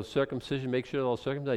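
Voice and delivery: usual speaking voice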